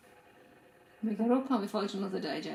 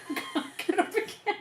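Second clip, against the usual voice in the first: high laughing whine; giggling that she can't get up; 11 semitones higher than usual. Real, not acted. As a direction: laugh through the complaint. Laughs while saying it.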